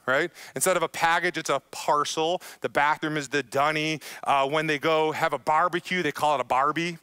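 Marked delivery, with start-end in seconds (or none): none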